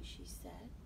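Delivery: ordinary delivery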